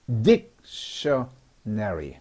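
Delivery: 딕셔너리 stretched out, low; slow demo word. Shown as a demonstration. Teacher models 딕셔너리 slowly for students. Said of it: "이렇게 나누면".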